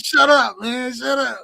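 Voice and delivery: high mock yell breaking toward laughter; hammed-up, high-pitched exasperation; mock protest. Retold retort, ends laughing.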